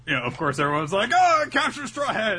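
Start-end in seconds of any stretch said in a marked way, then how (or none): 1.1-2.4 high, loud mock shout; hammed-up cry to attack; crowd outcry. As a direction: shout it like an eager mob. Crowd cries out to capture him.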